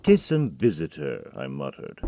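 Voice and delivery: ordinary delivery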